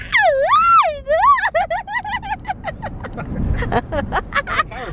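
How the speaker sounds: very high shrieking laugh; shrieking laughter. Real, not acted. Long laugh while tickled.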